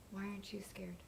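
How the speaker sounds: intense whisper; whispered challenge. Acted. Wife confronts husband, intense whisper. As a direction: hiss the question at him.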